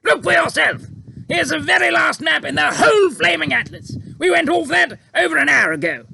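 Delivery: high, loud blustering officer; outraged officer. A narrator's reading. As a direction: Air Force chief shouting at the pilot, outraged. Air Force head voiced.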